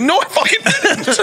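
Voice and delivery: very high, laughing denial; indignant denial. Retold or imitated. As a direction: squeal the denial in disbelief. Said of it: "He's like".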